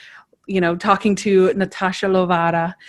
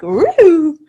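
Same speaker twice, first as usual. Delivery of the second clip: high, louder laughing clown whoop; 8 semitones higher, 6 dB louder than usual. Retold or imitated. Imitating the clown whooping behind serious Stottlemyre.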